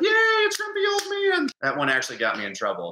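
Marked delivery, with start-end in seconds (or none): 0.0-1.5 very high, louder squealing cheer; imitating delighted kids; excited kids. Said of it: "Kid's like".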